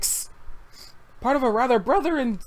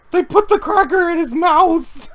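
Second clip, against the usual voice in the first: incredulous; 10 semitones higher, 7 dB louder than usual. Real, not acted. Incredulous laughing question.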